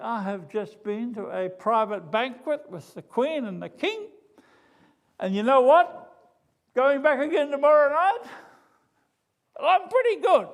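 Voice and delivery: swaggering high, loud boast; boastful Haman. Retold or imitated. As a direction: crow about it like a puffed-up fool. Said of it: "he parades up and down saying".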